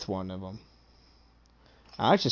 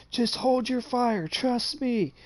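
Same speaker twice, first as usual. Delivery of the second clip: shouted order; 14 semitones higher, slower than usual. Retold or imitated. Player shouting to his team.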